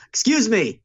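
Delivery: high-pitched, lightly imitated interruption; Trump interrupting.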